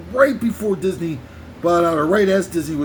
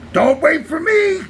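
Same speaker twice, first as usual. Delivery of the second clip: higher, louder Quint yell; shouted Quint; 7 semitones higher, 3 dB louder than usual. Retold or imitated. Quint yelling to Hooper as he goes, shouted. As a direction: yell it like Quint going under. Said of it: "my Quint is getting much better".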